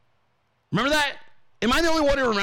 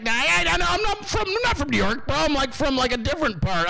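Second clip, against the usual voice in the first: high-pitched, stammering mock denial; mimicked nervous backpedaller; 8 semitones higher than usual. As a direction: imitate someone squirming to disown their hometown. Mimics New Yorker nervously disowning origins.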